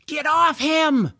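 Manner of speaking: high, loud, drawn-out command; yelling. A narrator's reading. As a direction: yell to call off an attacking animal. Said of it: "George yelled".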